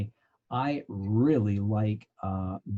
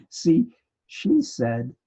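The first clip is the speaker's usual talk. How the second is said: excited explaining; 5 semitones higher, 5 dB louder, slower than usual. Retold or imitated. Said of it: "And I'm going".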